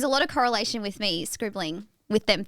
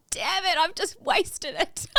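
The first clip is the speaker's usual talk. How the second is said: mock frustration; 7 semitones higher, slower than usual. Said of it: "I was a bit frustrated".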